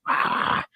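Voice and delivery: breathy, voiceless mock scream; venting cry. Shown as a demonstration. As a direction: let out a hushed scream of release. Demonstrates venting off his chest.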